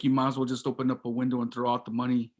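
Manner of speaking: normal talking voice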